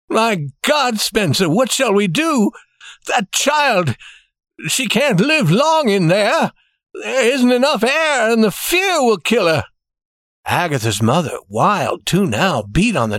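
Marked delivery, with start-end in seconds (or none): 0.1-9.6 high-pitched despairing alarm; shaking voice. A narrator's reading. Banker fearing for the child's life, distressed shaking voice. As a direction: appeal in rising dread, pitched up. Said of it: "in a shaking voice".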